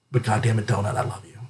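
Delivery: soft affectionate confession; tender. Real, not acted. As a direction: murmur it fondly to the cat.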